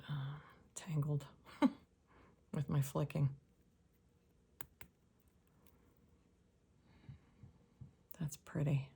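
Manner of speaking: soft whisper with a small laugh; whispered aside. Real, not acted. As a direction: murmur it under your breath, amused.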